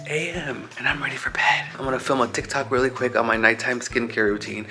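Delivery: late-night whisper, barely voiced. Real, not acted. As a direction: whisper so nobody wakes. 1 AM hotel room, late night.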